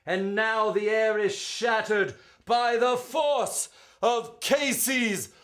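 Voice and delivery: rising and falling ballad read, full of drama; spirited verse reading; dramatic poem recitation. Acted. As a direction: recite it like a storyteller at the ballpark.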